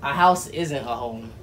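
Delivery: soft, sincere and heartfelt; tender proposal speech. Real, not acted. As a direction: speak softly, from the heart.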